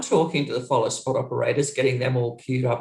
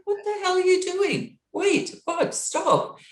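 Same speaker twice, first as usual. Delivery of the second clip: shocked protest; slower than usual. Retold or imitated. Iggy pulls her in, locks door.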